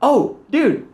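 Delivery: high-pitched starstruck double take; surprised recognition. Retold or imitated. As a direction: act out suddenly spotting someone you admire. Retold surprised recognition of Gary.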